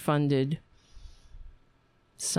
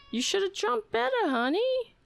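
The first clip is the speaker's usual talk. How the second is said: very high, quick pet voice; cooing gentle chiding. Real, not acted. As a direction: chide a pet in a high doting coo. Gently chiding pet in baby talk.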